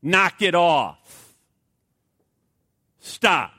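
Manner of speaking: firm command. Retold or imitated. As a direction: lay down the law word by word. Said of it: "what Paul is just saying".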